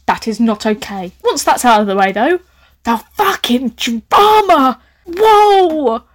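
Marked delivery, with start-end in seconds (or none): 2.8-6.0 slow, loud, high thrill at the drama. Real, not acted. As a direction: savour the drama, then whoa.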